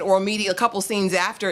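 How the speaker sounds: usual speaking voice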